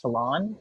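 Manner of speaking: "talon" as tuh-LON, stress on the second syllable; rhymes with salon. Shown as a demonstration. Card teacher showing how people say the term, "talon" rhyming with salon. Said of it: "you will hear it pronounced to rhyme with salon".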